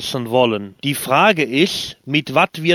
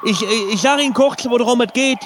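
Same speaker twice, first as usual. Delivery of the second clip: mock panic; 7 semitones higher than usual. Parachutist prank call continues.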